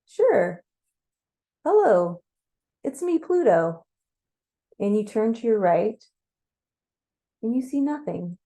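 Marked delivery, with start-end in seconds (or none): 0.0-3.9 lightly booming god, louder and lifted; booming Pluto greeting, pushed out loud. A narrator's reading. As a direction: announce yourself with a big, friendly boom. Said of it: "you hear a booming voice say".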